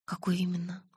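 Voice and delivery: whispered question; hushed. A narrator's reading. Girl asks Edward which question, whispering. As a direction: ask it under your breath.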